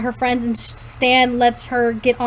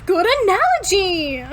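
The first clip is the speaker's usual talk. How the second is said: hammed-up protest with raised pitch; mock protest; 6 semitones higher than usual. A narrator's reading. Girl rejecting the fish analogy, mock indignant. Mock argument, reader breaking into laughter.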